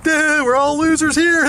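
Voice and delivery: high, slow, dopey put-on voice; mocking loser neighbor. Neighbors answering the speaker, high slow mocking voice. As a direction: drawl it out like a proud slacker. Neighbors mocked in retold quarrel.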